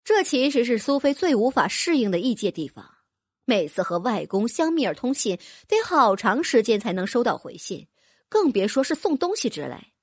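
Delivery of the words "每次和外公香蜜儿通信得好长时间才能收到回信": plain reading voice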